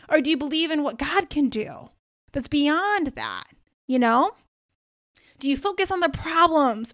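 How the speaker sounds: emphatic, rousing faith exhortation; preaching. Real, not acted. Speaker urging listeners to believe what God can do, preaching. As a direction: preach it in punchy, lifted phrases with pointed questions.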